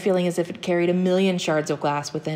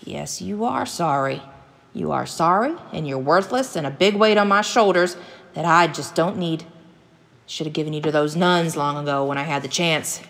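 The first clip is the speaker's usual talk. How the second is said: scornful father, building louder; hateful; 4 dB louder than usual. Acted. Hunter father belittling his boy after an apology, hateful. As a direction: throw his apology back at him with contempt. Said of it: "cast a hateful glance back toward his boy".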